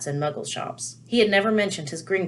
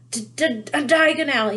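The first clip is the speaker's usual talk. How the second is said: choking stammer on hot ash, rising louder; coughing out the words; 8 semitones higher, 4 dB louder than usual. A narrator's reading. Harry chokes on ash while naming his destination in the fire, coughing. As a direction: sputter it through a throat full of ash. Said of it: "he coughed".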